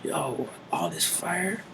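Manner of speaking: hushed and high, awestruck. Retold or imitated. John in a trance, overwhelmed, hushed and high. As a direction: whisper it like you've seen something terrible. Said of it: "he was like".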